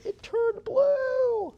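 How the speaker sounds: very high, loud, drawn-out squeal; comic exclamation. Pretend. Auctioneer joking his face turned blue, comic high squeal. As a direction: stretch it out way up high.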